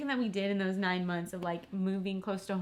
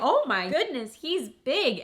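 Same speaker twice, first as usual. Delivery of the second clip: higher, louder amazed exclamation; surprised doctor; 6 semitones higher, 5 dB louder than usual. Retold or imitated. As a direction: gasp it out like the stunned doctor. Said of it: "she was like".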